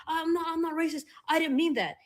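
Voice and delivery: high, defensive mock whine; a hammed-up flustered denial; mocking a backpedaler. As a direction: imitate someone squirming out of a racism call-out.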